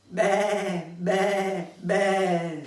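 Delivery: high, loud bleating "Be, be, be"; sheep bleat. Acted. Poet doing the chorus bleat for the audience. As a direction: bleat like a lamb. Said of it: "tenéis que decir".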